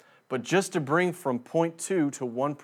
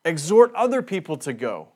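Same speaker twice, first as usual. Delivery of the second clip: higher, louder mock exhortation; emphatic misreading; 5 semitones higher, 7 dB louder, faster than usual. Shown as a demonstration. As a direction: push it as a rallying demand. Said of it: "Doesn't even say".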